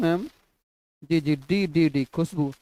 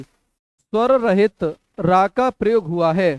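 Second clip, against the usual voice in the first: slow dictation; 3 dB louder, slower than usual. Shown as a demonstration. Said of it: "dictating question slowly".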